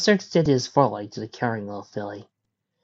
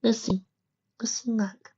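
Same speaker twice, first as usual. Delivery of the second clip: high, soft filly voice; shy little filly; 11 semitones higher, 4 dB quieter than usual. A narrator's reading. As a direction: shyly offer it in a tiny high voice. Shy filly gives her name.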